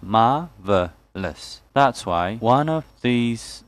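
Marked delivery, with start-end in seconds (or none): 0.0-1.6 "Marvelous" slowly, in three syllables; split into syllables. Shown as a demonstration. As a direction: break "Marvelous" into mar, ve, lous. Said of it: "there are three syllables".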